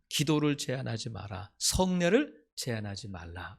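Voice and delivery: ordinary talk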